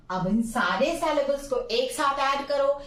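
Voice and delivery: ordinary talk, nothing marked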